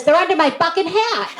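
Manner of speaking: put-on high kid voice breaking into laughter; laughing punchline. Kid answering the homeowner, laughing through the line. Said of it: "little problem with speech".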